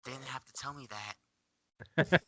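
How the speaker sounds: very soft whisper back; hushed, barely-there whisper; whispered reply. Acted. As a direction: whisper it confidentially into an ear. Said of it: "He whispers back".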